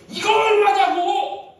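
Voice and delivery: high, drawn-out insistent yell; shouting. Acted. Grandpa pressing startled staff for the price, shouting. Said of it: "막 소리를 지르니까".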